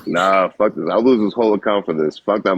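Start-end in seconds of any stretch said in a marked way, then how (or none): none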